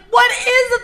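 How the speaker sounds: retold panicked question, very high; scared. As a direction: imitate alarmed, high-pitched bewilderment. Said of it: "it was so scary".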